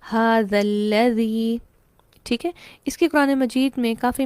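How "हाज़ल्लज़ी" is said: हाज़ल्लज़ी very slow, short a, doubled l; correct reading. Shown as a demonstration. Teacher answers how it is read, very slow. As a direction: drop the long a, press the double l in हाज़ल्लज़ी. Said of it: "लिहाजा कैसे पढ़ा जाएगा?".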